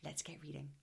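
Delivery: ordinary talk, nothing marked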